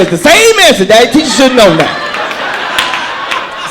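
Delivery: put-on high, loud, slow matter-of-fact child; daughter's voice. Daughter defending her arrows to dad, high and sure. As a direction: explain it like it's obvious. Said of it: "She said".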